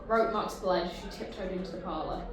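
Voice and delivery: normal talking voice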